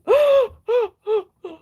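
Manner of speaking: loud high gasp of delight; sharp excited gasp. Real, not acted. Host gasps at the planner reveal, delighted. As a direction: gasp like you just saw a treasure.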